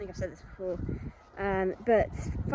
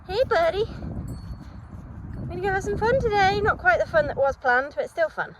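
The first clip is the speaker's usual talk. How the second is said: pet talk; 9 semitones higher than usual. Real, not acted. Talking fondly to her horse.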